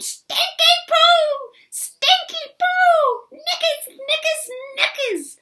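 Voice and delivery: very high, loud slow witch screech; witch tantrum scream. Acted. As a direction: screech the silly curses furiously. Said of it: "she screamed".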